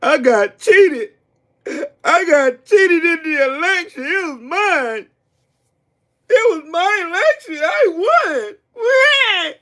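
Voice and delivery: high, drawn-out fake crying; mock whining. Streamer mimicking crying Trump voters, mock whiny wail. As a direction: wail it like a hammed-up sore loser. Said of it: "Y'all cry and whine and bitch and moan".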